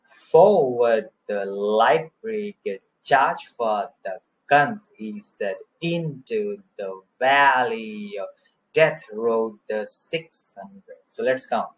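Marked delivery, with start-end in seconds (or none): 0.0-4.9 slow verse, heavy on FORWARD, LIGHT, CHARGE, GUNS; dactylic beat. Shown as a demonstration. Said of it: "Let's see". 5.8-11.0 slow verse, stretched stress on INTO, VALLEY, DEATH; dactylic beat. Shown as a demonstration. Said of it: "Let's see".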